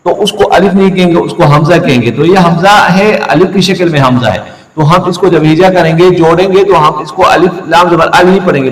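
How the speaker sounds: ordinary talk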